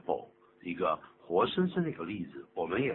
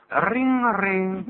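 high, loud, drawn-out ringing noise; imitated phone ring; 12 semitones higher, 8 dB louder, much slower than usual. Teacher acts out a phone ringing, high drawn-out ring. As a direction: trill it like a telephone bell. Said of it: "电话铃一响的时候".

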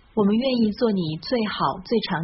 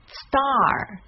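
"star" stressed, with a curled r; 3 semitones higher than usual. Shown as a demonstration. Teacher isolates the stressed first syllable star. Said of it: "重读也在第一音节上".

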